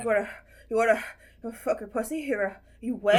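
lightly imitated mouth-breathing creep; halting mouth breather, in fragments. Co-host imitating an obscene phone caller, mouth breather. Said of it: "do the mouth breather, like, way he's saying it".

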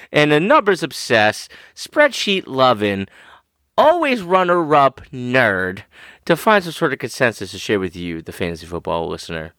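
ordinary delivery

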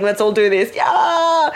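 loud rallying call; team shout. Retold or imitated. Husband rallying everyone in the delivery room, shouting. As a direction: pump up the team. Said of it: "teamwork, shouting".

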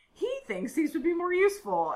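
high-pitched put-on cartoon assistant voice; Miss Minutes, chipper. Podcaster voices the character handing over files, high and chipper. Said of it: "and she's like".

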